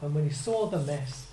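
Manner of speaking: normal talking voice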